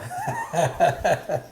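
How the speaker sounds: strained laugh through the heat; pained laugh. Real, not acted. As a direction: laugh it off while burning.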